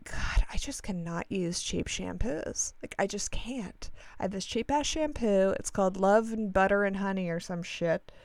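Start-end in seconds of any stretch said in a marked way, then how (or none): 0.0-3.9 soft whisper of an inner thought. Retold or imitated. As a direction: whisper it to yourself, a little slow. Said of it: "And I'm thinking".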